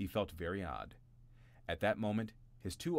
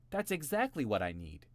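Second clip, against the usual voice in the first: happily; 5 semitones higher, 4 dB louder than usual. A narrator's reading. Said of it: "he said happily".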